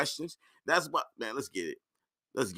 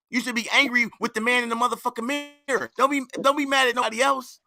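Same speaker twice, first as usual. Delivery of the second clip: heated rant; 4 semitones higher than usual. Real, not acted. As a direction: tear into him, fired up. Host berates guest on clip.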